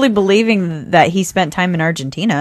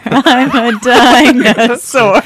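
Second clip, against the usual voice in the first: very slow, louder and higher, laughing; hammed-up dinosaur; 6 semitones higher, 9 dB louder, much slower than usual. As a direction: ham up the dinosaur lyric. Repeats dinosaur line louder.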